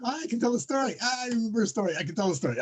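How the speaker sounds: high-pitched, hammed-up eagerness to tell a story; eager mock storyteller. Lecturer acting out someone keen to tell a story, high mock eagerness. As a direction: pipe up high, mock-excited, then settle a little.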